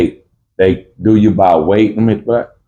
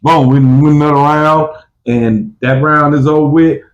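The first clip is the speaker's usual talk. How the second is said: hyped fighter; 6 semitones higher than usual. Retold or imitated. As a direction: call the round over. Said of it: "I'm like".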